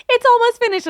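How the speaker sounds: high mocking singsong; mocking quote. Mimicking people who say the tour is nearly over, high mock singsong. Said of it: "everyone being like".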